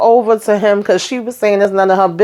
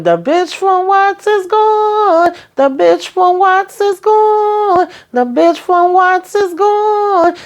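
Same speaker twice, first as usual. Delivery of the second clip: very high, drawn-out slow-motion chant; 12 semitones higher, much slower than usual. Retold or imitated. Lily stomping upstairs as she leaves, slow-motion chant. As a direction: stretch every word out slowly in a high put-on voice. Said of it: "going slow motion and stomping up".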